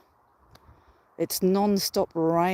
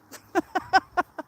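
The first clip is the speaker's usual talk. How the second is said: laugh; 11 semitones higher than usual. Real, not acted. Laughing after talking to Nathan.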